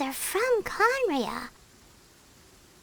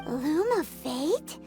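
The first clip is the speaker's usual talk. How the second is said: slow, lower question rising at the end; puzzled echo; 6 semitones lower, 3 dB quieter, much slower than usual. Acted. Companion hears a mysterious term, puzzled echo. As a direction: sound out the unknown term.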